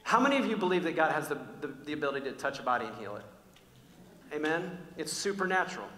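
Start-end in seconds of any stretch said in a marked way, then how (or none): none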